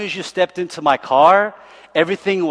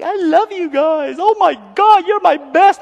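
much higher, gushing and loud, mock-adoring; hammed-up gushing driver voice, much higher; 5 dB louder than usual. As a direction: play the overeager tour driver gushing over his passengers. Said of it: "He was like".

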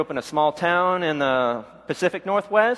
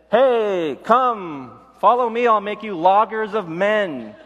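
louder, higher hail up the road; calling out; 3 semitones higher, 3 dB louder, slower than usual. Retold or imitated. Jesus hailing the logging crew, calling out. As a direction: call the loggers over. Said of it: "and said".

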